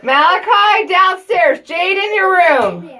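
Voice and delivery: loud, high call down the stairs. Real, not acted. Mother calling her kids downstairs, loud and high. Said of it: "I have to raise my voice for them to hear me".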